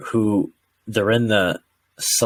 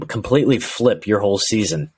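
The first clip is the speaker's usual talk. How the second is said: normal talking voice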